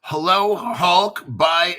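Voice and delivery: ordinary talk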